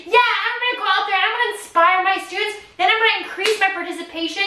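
high, eager declaration; fired up and ready to go; pumped-up teacher. Retold or imitated. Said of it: "I want you to be like".

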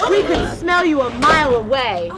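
loud taunting call. Acted. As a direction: taunt the villain loudly. Hero taunts the villain.

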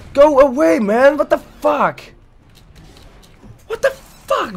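high, loud panicked yells; panicked yelling. Real, not acted. Gamer fleeing a monster, high panicked yelling. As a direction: shout in panic to get it away.

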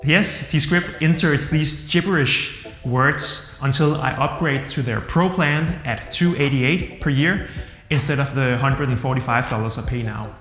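ordinary talk

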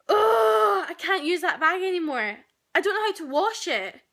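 loud, high, exasperated cry over the ruined bag; livid outburst. Retold or imitated. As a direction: blurt it out furious and fed up. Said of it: "I have never been so livid".

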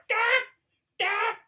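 high squealing mock shriek; imitating his scream over the card. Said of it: "You can just hear me go".

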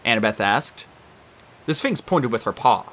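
ordinary delivery, nothing marked

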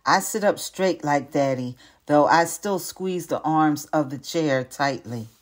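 plain reading voice

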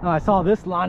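usual speaking voice

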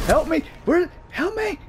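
high desperate cry. Real, not acted. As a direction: plead for help, voice shooting up. Desperate call to teammate.

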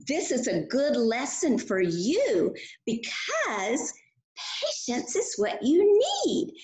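normal talking voice

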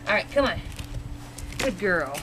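normal talking voice

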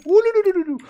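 very high, loud ululating whoop; excited ululating cry. Real, not acted. Player celebrating a kill to his viewers, very high ululating whoop. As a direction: let out a wild victory trill. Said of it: "Empolguei, empolguei".

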